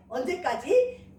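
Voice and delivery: ordinary delivery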